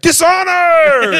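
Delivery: high, drawn-out hammed-up shout; mock battle cry. Defenders yelling as troops run the beach, mock outraged shout. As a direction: bellow it like an outraged warrior.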